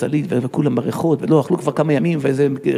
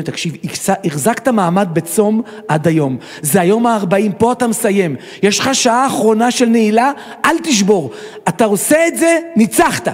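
rousing pep talk; 5 semitones higher, slower than usual. Retold or imitated. Friend urges him to hold out the last hour, rousing. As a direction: cheer a weary friend over the finish line. Friend voiced cheering on the faster.

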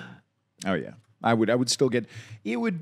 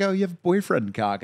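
higher, louder mock gushing woman; girl's voice; 11 semitones higher, 5 dB louder than usual. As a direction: fawn over him. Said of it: "They'd be like".

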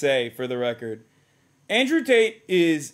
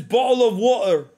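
bottle of water in a put-on British accent; lightly mocking British accent, slightly louder; 4 semitones higher, 4 dB louder than usual. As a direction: parody the streamer faking a British voice. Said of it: "not even actually British".

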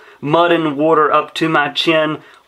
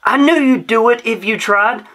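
cried out; 6 semitones higher than usual. A narrator's reading. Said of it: "Sammy cried".

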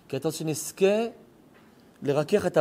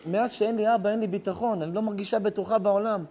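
higher, pleading retelling; a hint of a weeping girl, pitched up; retold girl's lament; 7 semitones higher than usual. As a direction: voice her helplessness tearfully. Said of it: "היא אמרה לי את המשפט הזה".

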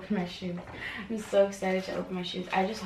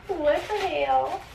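amazed; 6 semitones higher, 4 dB louder, slower than usual. Real, not acted. Stunned by shoes.

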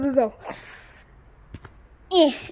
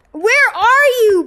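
high, loud, slow yell; frantic yell; 8 semitones higher, 8 dB louder, slower than usual. Real, not acted. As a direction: call out desperately. Lost during speedrun, yelling.

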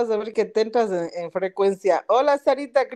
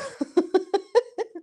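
high laugh; 10 semitones higher than usual. Real, not acted. Laughing at the gossip bomb.

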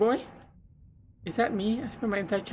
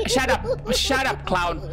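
high mock-angry yell at the clown. Real, not acted. Gamer yelling at a scary clown, high angry yell. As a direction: snap shut up at the creepy clown.

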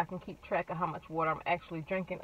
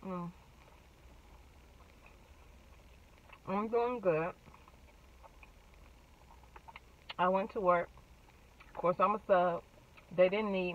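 chewing while talking; mouth full; 4 dB quieter than usual. Real, not acted. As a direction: food in mouth. Seen 3: Bit food at -5, holds bun, chewing lips while talking.

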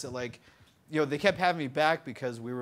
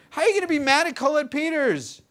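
much higher and louder, indignant disbelief; incredulous; 12 semitones higher, 9 dB louder than usual. Retold or imitated. Rebuking the angry runner-up about Colette, incredulous and loud. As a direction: throw it at him like it's absurd. Defending Colette against sore loser.

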